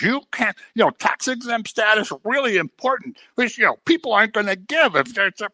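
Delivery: mildly mock the complaining man; angry churchgoer. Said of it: "He goes".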